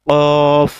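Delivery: কফ said loud and deep from the throat; deep qaf. Shown as a demonstration. Teacher shows the throat-deep qaf, loud. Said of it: "ভিতর থেকে উচ্চারণ হবে".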